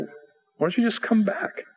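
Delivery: high, drawn-out plea; coaxing appeal. Retold or imitated. Preacher asking backslidden friend to come back, coaxing appeal. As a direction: coax him back with a lifted, lingering question.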